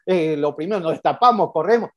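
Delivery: normal talking voice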